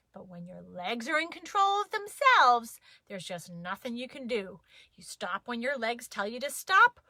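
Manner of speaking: ordinary delivery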